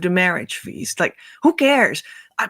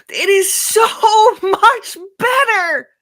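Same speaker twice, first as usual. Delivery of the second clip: crying out; 11 semitones higher, 3 dB louder than usual. Real, not acted. Teaching on the second half of life, she cries out in joy. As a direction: break into a crying shout of joy. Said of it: "I'm just always crying".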